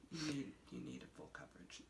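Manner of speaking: very low, very soft, fast verdict; deadpan makeup expert. Retold or imitated. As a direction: murmur it like a grave expert. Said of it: "'Cause he was so serious".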